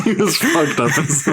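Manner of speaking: mock-quoting through laughter; teasing echo, laughing it out; laughing while repeating. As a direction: repeat his words, cracking up. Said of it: "That's such a mean way to put it".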